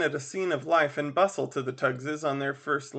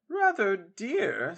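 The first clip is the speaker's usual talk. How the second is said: doubtful matron; 5 semitones higher, much slower than usual. Acted. Mrs Tuggs objects to price.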